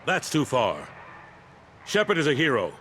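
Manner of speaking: indignant outburst defending a hero; indignant defence. Acted. Councilor defends Shepard against treason charge.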